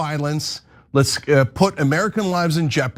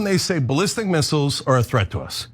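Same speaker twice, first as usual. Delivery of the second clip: ordinary talk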